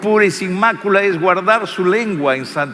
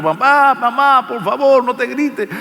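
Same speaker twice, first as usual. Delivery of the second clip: high, loud imitated child begging; preacher voicing a child begging parents to stop shouting; pleading child; 9 semitones higher, 4 dB louder than usual. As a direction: plead like a frightened kid. Children beg fighting parents.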